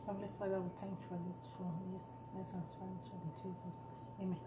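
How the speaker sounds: soft, hushed grace before eating; quiet prayer. Real, not acted. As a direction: murmur a quick prayer over the food. Prays over the food.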